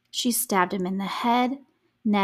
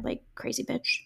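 breathy whispered insult, under the breath; whispered aside; 4 semitones higher, 6 dB quieter than usual. Real, not acted. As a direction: hiss it as a private aside. Muttered aside about Susan.

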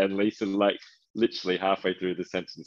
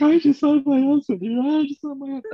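much higher and louder, whiny; mock crying wail; 8 dB louder than usual. Mocking Lisa crying about her husband, high whiny wail. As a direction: parody a tearful wail. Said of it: "she starts crying".

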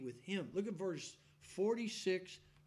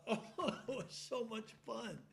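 laughing; 4 semitones higher than usual. Real, not acted. Remembering joyful church.

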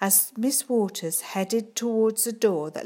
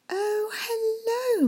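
much higher, slow sugary hello; sweet act; much slower than usual. Acted. Teacher covers herself to the head teacher, sugary. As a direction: pour on fake sweetness.

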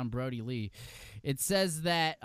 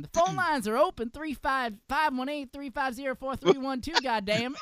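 raised-pitch mock Southern phone-in plug; put-on Southern accent; 7 semitones higher, 5 dB louder than usual. Podcaster jokingly plugs the phone lines, put-on Southern accent. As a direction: read out the number in a hammed-up Southern voice. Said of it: "I cannot do a Southern accent".

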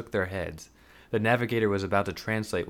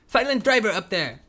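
high, loud pointed reply; exasperated retort; 12 semitones higher, 6 dB louder than usual. Acted. Sam pointing at the driver, exasperated. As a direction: snap it pointedly.

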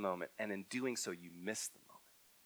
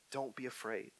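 gentle reassurance; 9 dB quieter than usual. Acted. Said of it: "he said".

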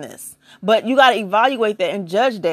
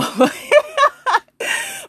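a burst of high-pitched laughter. Real, not acted. Laughing at her own bit to viewers, high laugh.

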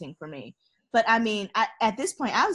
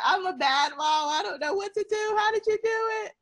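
much higher, crying voice; crying to her own mother, high and desperate; tearful wail; 12 semitones higher than usual. Retold or imitated. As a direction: wail it like a breaking-down mom. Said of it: "I'm like".

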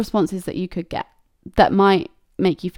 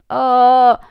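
loud, higher mock groan of regret; drawn-out "oh"; 4 semitones higher, 7 dB louder than usual. Acts out sinking regretful feeling.

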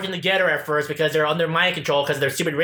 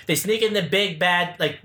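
normal talking voice

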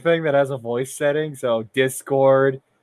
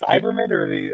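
normal talking voice

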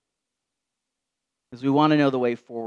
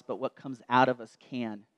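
normal talking voice

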